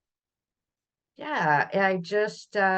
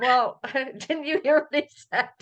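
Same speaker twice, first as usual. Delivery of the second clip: higher-pitched quote broken up by laughter; laughing retort; 7 semitones higher than usual. Retold or imitated. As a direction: answer a puzzled friend while laughing. Retells own reply, laughing.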